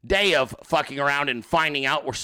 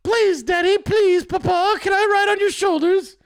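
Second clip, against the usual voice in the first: put-on very high, loud pleading child voice; mock a little girl begging for a ride; Meghan McCain; 12 semitones higher, 5 dB louder than usual. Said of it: "I bet that's how she said it too".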